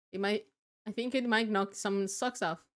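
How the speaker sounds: ordinary talk, nothing marked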